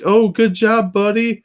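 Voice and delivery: put-on high, sing-song praise to a child; patronizing. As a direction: talk down like praising a little kid. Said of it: "you're like".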